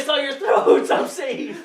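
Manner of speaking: mock Russian inmate through laughter; Russian accent. Friends mocking the Russian inmate, laughing Russian accent. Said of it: "thick Russian accent".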